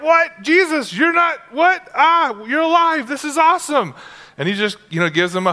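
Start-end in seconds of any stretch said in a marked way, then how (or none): 0.0-3.9 high, astonished squeals turning to joy; women shocked that Jesus is alive, gasping then thrilled; stunned disbelief. Acted. Women at the tomb shocked to see Jesus, astonished and overjoyed.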